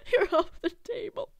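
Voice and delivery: high, laughing repeat of the line; amused repeat. Acted. Player cracking up at their own line, amused high repeat.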